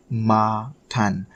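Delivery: "makan" slowly split as "ma... kan"; syllable by syllable. Shown as a demonstration. Teacher repeating the word for learners, slowly in two syllables. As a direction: stretch both syllables of "makan". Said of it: "Kan is sort of like".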